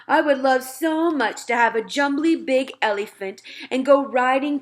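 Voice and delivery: longing for faraway fruit; dreamy giant voice. Acted. Giant telling Sophie his wish, dreamy and wistful.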